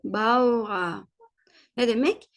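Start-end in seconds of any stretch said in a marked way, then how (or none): 0.0-1.1 "Bawa" as bau-a, ending in a; German pronunciation demo. Shown as a demonstration. Teacher invites learners to join, "Bawa" as bau-a. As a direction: say "Bawa" drawn out for repetition. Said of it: "bu şekilde benimle birlikte telaffuz edin".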